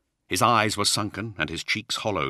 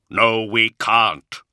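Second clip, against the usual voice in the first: flat; 5 semitones higher, 8 dB louder, much slower than usual. Acted. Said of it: "Luther said flatly".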